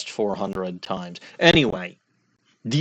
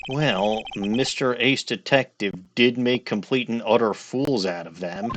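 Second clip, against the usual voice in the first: normal talking voice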